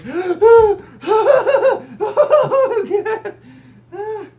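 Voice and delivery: high, loud horrified gasp; imitated gasp. Mom at ladder's foot sees son nearly fall, loud gasp. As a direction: gasp like a mom watching her son wobble on the roof. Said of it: "Bill's mom is at the bottom just like".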